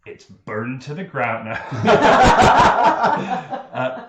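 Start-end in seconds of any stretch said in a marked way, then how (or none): 1.7-3.7 big cackling laugh; loud laugh. Real, not acted. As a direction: burst of high laughter. GM laughs at burned tomb.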